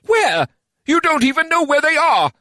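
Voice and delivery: angry retort. Acted. Heated council argument.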